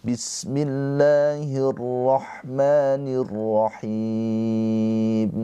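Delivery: slow, melodic tajwid chanting; measured Quranic recitation. Real, not acted. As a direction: recite each word long and sung.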